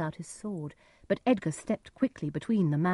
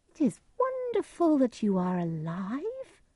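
high, slow, marvelling stranger; disguised stranger's voice; 8 semitones higher than usual. A narrator's reading. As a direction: put on a high voice, full of wonder. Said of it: "Edgar used a different voice".

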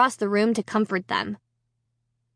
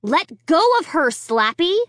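demanding; 6 semitones higher than usual. A narrator's reading. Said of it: "I demanded".

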